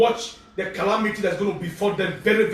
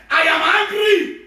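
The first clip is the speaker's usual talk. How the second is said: slow, loud, higher booming divine anger; God's voice; 9 semitones higher, 5 dB louder, slower than usual. Retold or imitated. Pastor voicing an angry God to his servant, slow booming. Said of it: "Say, "My servant, look at them".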